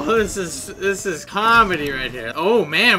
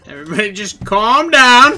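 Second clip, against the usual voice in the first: loud and higher, rising at the end; raised, panicky plea; 5 semitones higher, 10 dB louder than usual. Real, not acted. Hidden player as hunters close in, raised panicky plea. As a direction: say it much louder, pitch climbing. Repeats louder, hunters closing in.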